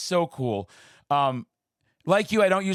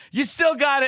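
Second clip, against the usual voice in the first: high, loud cheering cry; excited shout; 11 semitones higher, 4 dB louder than usual. Retold or imitated. Starstruck fan cheering Elvira on, high excited shout. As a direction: shout it out, thrilled and teasing. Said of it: "And I was like".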